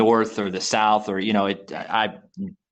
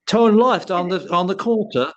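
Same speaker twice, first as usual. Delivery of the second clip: bad British impersonation; 9 semitones higher than usual. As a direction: imitate a British GPS giving directions, badly. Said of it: "it's your bad, you know, impersonation".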